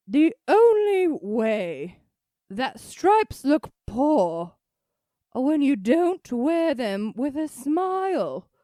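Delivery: loud, high, very slow swooping impression; Julia Child. Host delivering own style advice in a Julia Child voice. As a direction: stretch it out grandly, like a TV chef. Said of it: "I'm gonna use my Julia Child voice".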